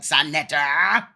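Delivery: growled-out name, drawn out slowly. Retold or imitated. Host mocking how she growled the name, drawn-out growl. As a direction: imitate her growl, stretching the name. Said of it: "Yeah, she growled".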